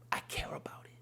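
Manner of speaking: hushed whisper; tender whispered reassurance. Retold or imitated. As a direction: breathe it like a secret. God's tender aside.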